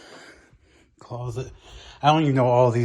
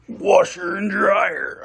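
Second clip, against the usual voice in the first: very slow, higher, drawn-out goofy voice; drawn-out mock announcer; 7 semitones higher, 4 dB louder, much slower than usual. Repeating washer and dryer in a slow, high, drawn-out mock voice. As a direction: stretch each word like a cheesy game show host.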